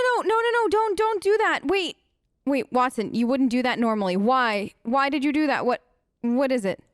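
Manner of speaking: ordinary talk, nothing marked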